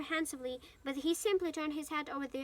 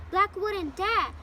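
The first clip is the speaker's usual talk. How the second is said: loud outburst; 5 semitones higher, 9 dB louder, much slower than usual. Acted. Ron protests loudly.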